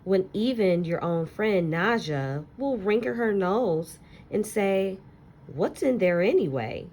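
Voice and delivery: put-on expressive read-aloud to children, lingering on key words; story narration. Teacher reading a picture book to her class, expressive storytelling.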